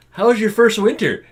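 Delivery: high, loud, eager asking; exaggeratedly cheery inquiry; bright teasing question. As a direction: chirp it at a newcomer.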